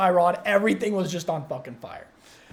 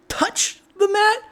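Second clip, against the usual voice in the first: tense dread; 13 semitones higher, 3 dB louder, slower than usual. Retold or imitated. Watching the fire spread.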